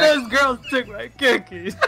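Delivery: high, slow, laughing mock complaint; joking victim of cookie theft, laughing. Real, not acted. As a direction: whine it through laughter.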